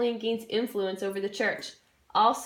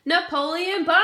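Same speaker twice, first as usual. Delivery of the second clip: higher, louder hammed-up fanfare; grand announcement; 7 semitones higher, 6 dB louder, faster than usual. Introducing Napoleon to the class with mock fanfare. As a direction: announce him like a star.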